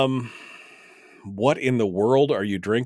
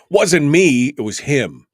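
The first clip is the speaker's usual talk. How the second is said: mock defence, loud; 4 semitones higher, 10 dB louder than usual. Shown as a demonstration. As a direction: deny it loudly as a joke. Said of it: "repeated louder".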